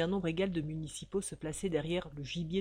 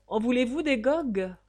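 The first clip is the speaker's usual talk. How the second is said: raised, irritated grumble; mock-indignant grousing, pitched up; grumbling; 6 semitones higher, 7 dB louder than usual. A narrator's reading. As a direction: grouse it out loudly. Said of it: "groumait J B".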